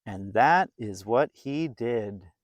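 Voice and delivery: put-on lively read-aloud voice for a children's story; storybook narration. A narrator's reading. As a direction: tell it like a bedtime picture book.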